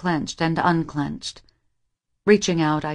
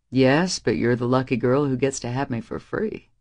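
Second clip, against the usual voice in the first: a hint of a deeper, teasing young man; Reese teasing; 5 semitones lower than usual. A narrator's reading. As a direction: lower male voice, light challenge.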